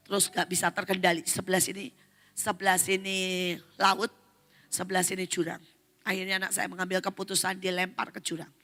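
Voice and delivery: ordinary talk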